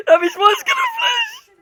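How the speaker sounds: very high frightened shriek; shrieking panic. Real, not acted. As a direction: squeal in dread. Dreading screen flash.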